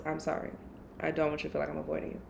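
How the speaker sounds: soft rushed apology to an avoided friend; soft quick apology. Retold or imitated. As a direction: murmur the apology gently and fast. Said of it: "That's the first thing I said was".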